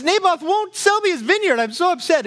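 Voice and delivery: high, petulant whine of a sulking king; whining king Ahab. Acted. King Ahab whining to his wife Jezebel in a high voice. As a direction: sulk like a spoiled king who can't get his way. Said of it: "He's whining".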